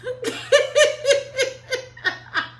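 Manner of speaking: loud high laugh breaking out; high burst of laughter. Real, not acted. She laughs hard at her own retort, a loud high laugh. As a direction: cackle at her own comeback.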